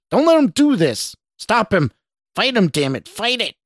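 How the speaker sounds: high-pitched frantic yelling; acted panicked shouting; shouted. A narrator's reading. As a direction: urge him desperately to resist. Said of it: "the stranger shouted".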